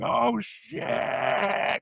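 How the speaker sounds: high startled yelp of the drop; playful high shocked cry at the drop; mock roller coaster yelp. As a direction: squeal it like the coaster just fell.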